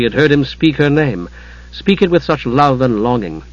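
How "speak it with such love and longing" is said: ordinary delivery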